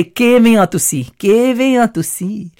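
refined Punjabi greeting, a touch put-on; posh Punjabi. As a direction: say it like a prim Ludhiana lady. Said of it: "very stylish and very prim and proper".

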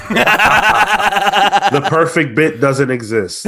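slow and high through laughter; laughing. Real, not acted. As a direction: say it while cracking up. Laughing at the failed joke.

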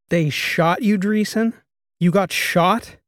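can't get past the words shot at you; stunned disbelief. A narrator's reading. Karsten hearing someone shot at Karolina, stunned. Realizes she was hit.

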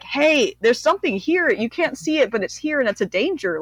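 high-pitched urgent warning, not truly shouted; mock warning cry. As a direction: voice the body's alarm, pitched well up. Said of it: "like, screaming at me".

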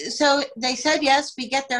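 ordinary talk

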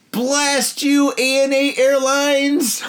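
high, drawn-out cry of mock outrage; mock curse. Drinker cursing the airline over lost beer, mock-outraged wail. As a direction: curse them like a thwarted villain.

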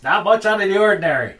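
high, loud defensive reply; agitated answer. Acted. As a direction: answer loudly, still worked up. Agitated character under questioning.

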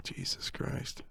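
slow, breathy exasperated Jesus. Retold or imitated. Jesus hearing the boys' prayer, slow breathy exasperation. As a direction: sigh it out like a fed-up Jesus. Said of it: "That's probably what he said".

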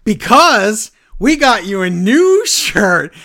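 mock Reigns booming the surprise; mock announcement. Reigns big reveal.

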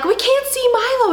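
put-on high alarmed call for the lost boy; girls calling out. Girls calling to mom, alarmed high call. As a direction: daughters shouting that Milo is gone. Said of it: "So the girls are like".

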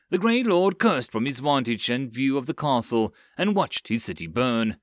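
ordinary delivery

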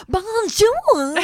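high, precious bonjour; a child showing off her French; prissy little girl. Retold or imitated. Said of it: "she's like".

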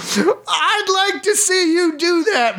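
high, slow mock challenge; hammed-up dare, stretched out; acted angry dare. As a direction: goad him in high-pitched mock fury. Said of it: "Major Kurth replied".

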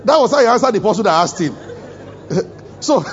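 cracking up while telling the congregation; laughing. Real, not acted. Preacher laughing at the story.

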